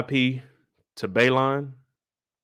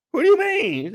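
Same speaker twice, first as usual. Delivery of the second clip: alarmed; 12 semitones higher, 4 dB louder than usual. Retold or imitated. King alarmed.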